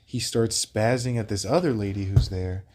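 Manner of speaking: ordinary talk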